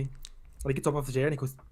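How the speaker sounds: ordinary delivery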